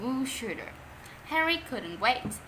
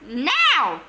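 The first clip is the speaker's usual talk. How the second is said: louder one-word command; screamed order; 3 semitones higher, 9 dB louder than usual. Acted. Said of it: "screamed Mom".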